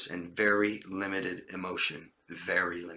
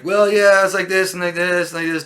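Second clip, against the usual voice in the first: much higher, louder liar's babble; raised, unconfident voice; 9 semitones higher, 10 dB louder than usual. Shown as a demonstration. Teacher showing how a liar talks, raised unconfident voice. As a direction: voice shooting up as you fake it. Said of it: "my voice starts racing, and I'm not confident".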